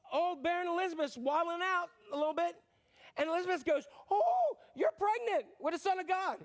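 high, loud exclamation; excited reveal. Acted. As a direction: burst out the news.

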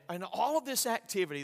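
normal talking voice

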